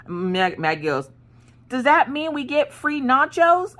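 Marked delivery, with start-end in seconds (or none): 1.7-3.7 high, drawn-out hopeful question; imitated kid excitement. Maggie asking mom about free nachos, imitated excitement. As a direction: mimic an eager child, pitch way up.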